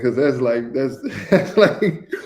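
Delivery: laughing through the words. Real, not acted. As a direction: chuckle while you talk. Laughs at own dig at ACC.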